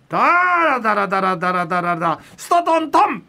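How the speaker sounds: clumsy da-ra drumroll ending in a rimshot; vocal drumroll. Pretend. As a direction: rattle a drumroll, then hit the cymbal. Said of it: "じゃあ、いきますよ".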